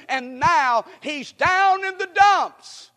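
slow, ringing pulpit exclamation; dramatic proclamation. Real, not acted. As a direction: build it high and slow for the congregation. Preacher dramatizes the prophet's fall.

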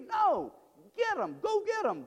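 high, forceful cry; urgent, high-pitched preacher's exhortation; rousing call. Real, not acted. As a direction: cry out a rallying charge. Rejects sitting back, urges action.

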